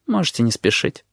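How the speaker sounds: mildly detached remark; indifferent. A narrator's reading. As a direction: toss it off with no urgency. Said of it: "сказал он безразличным тоном".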